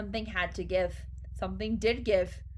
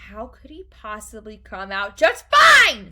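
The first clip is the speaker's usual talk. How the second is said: loud, angry retort building to a shout; snapped; 16 dB louder than usual. A narrator's reading. Mother disbelieves Wonka's assurance, snapped. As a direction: snap back, blasting the last words. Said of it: "snapped Missus Gloop".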